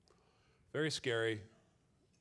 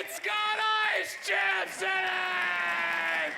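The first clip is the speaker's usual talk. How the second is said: much higher, louder, slow shrieking panic; hysterical class screaming; 5 dB louder, much slower than usual. Retold or imitated. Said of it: "They're like".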